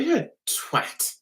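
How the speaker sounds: soft, slow private insult; muttered thought. Retold or imitated. Thinking about the man with the fringe, quiet slow mutter. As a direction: say it under your breath. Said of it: "I thought".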